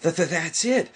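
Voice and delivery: high, stammering burst of excitement; excited cry. A narrator's reading. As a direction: blurt it out thrilled. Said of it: "cried Piglet excitedly".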